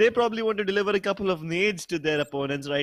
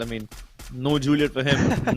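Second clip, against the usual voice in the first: normal talking voice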